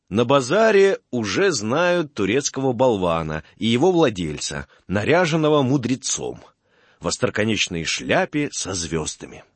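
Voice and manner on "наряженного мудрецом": ordinary delivery, nothing marked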